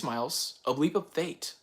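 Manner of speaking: ordinary delivery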